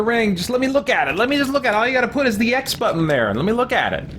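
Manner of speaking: frustrated, begging to see it; exasperated pleading. Real, not acted. Menu won't show the ring.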